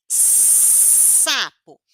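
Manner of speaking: SAPO with a long hissed opening S; prolonged S. Shown as a demonstration. Teacher introducing the sound of S, long hiss. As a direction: stretch the S before releasing -APO. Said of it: "o S".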